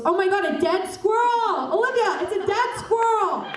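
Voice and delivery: high, excited mock yell of the friend; imitating Victoria's high excited shout. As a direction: squeal it like an overexcited friend.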